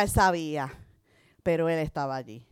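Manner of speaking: normal talking voice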